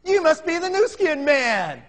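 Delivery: high, slow, sing-song grandma voice; drawn-out, innocent old lady greeting; sweet old lady. Retold or imitated. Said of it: "She said".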